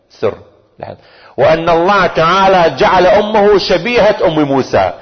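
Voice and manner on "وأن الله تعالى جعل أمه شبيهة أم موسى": high, slow proclamation; impassioned preaching. Acted. Imam answering his aunt about the mother, impassioned preaching. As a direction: let the revelation ring out.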